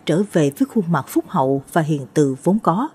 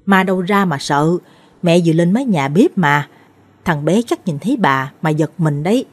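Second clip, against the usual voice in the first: plain reading voice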